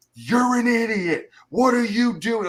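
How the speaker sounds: high-pitched slow mock rant at a teammate; mock berating yell. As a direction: ham up a crusty veteran chewing out a rookie. Yelling veteran berating teammate.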